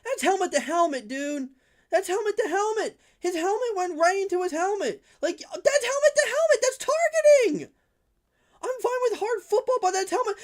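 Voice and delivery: real fan fury at a dirty hit; rising indignant yell; worked-up rant. Real, not acted. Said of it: "I'm not trying to get worked up".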